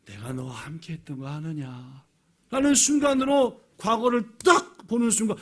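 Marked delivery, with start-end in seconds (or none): 0.1-2.0 soft, low voice of God; gentle reminder. Acted. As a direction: drop to a tender, quiet question. God reminding David of His presence. (낮고 부드럽게 일깨우는 하나님의 음성)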